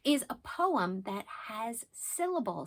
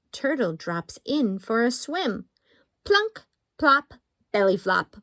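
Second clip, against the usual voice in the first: slow, lilting haiku reading; picture-book poetry voice; reading poems to kids; 3 semitones higher, 8 dB louder, slower than usual. Acted. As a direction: read each haiku playfully to little ones.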